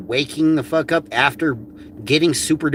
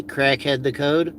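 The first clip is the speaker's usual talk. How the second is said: ordinary talk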